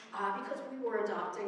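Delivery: ordinary delivery, nothing marked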